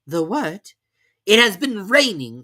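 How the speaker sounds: character voices. A narrator's reading. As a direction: swap into the characters.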